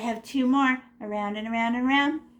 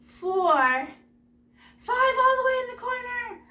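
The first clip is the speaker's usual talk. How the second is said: playful sing-song; 13 semitones higher, much slower than usual. Acted. Playful flying to the corner.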